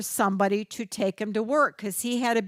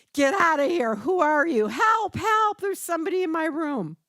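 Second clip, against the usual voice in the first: mock frightened scream; 7 semitones higher than usual. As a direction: cry out in fear at a stranger. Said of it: "starts screaming and says".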